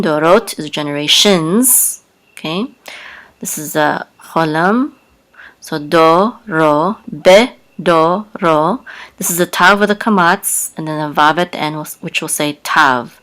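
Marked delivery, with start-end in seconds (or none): 5.6-8.8 "do, ro, be, do, ro" in separate syllables. Shown as a demonstration. Teacher breaking down a Hebrew word, syllable by syllable. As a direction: sound out each piece of bedorotav.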